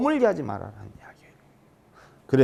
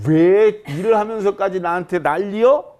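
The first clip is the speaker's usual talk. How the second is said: higher, louder indignant complaint; irritated retort; 6 semitones higher, 6 dB louder than usual. Acted. Wife complaining about her husband's scolding, irritated outburst. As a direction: snap back at him, indignant.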